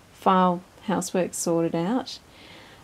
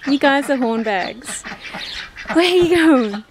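voicing the drakes; 5 semitones higher, 5 dB louder than usual. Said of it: "The boys, when they do it".

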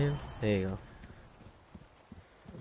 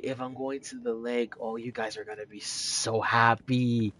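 usual speaking voice

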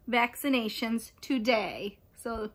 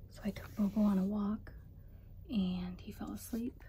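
hushed whisper, much softer; 8 dB quieter than usual. Real, not acted. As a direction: whisper so he stays asleep. Baby asleep, mom whispers.

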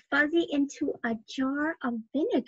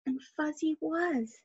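ordinary delivery

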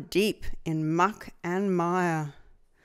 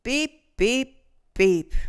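truck beeps; 3 semitones higher, 4 dB louder, slower than usual. A narrator's reading. Truck drives off.

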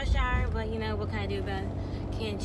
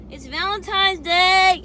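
high, loud, slow cheer; excited; 10 semitones higher, 13 dB louder, much slower than usual. Real, not acted. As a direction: sing it out like a celebration. Cheery greeting to camera.

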